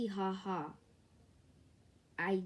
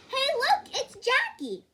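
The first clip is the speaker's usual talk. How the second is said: calling out; 9 dB louder than usual. Acted. Kids calling out.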